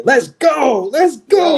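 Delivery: high-pitched hyped cheer; excited cheering. Real, not acted. Cheering a character announcement.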